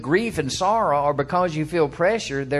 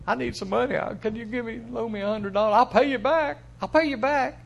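higher, pleading borrower asking for a loan; 8 semitones higher than usual. Retold or imitated. A borrower begging a friend for a loan, pleading. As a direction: beg for the loan, a touch whiny.

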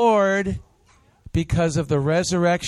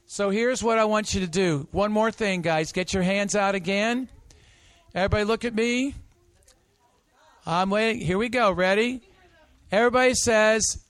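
usual speaking voice

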